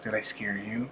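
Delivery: put-on a creepy little girl's hushed taunt; spooky soft question from behind a tree. Creepy girl peeks from tree.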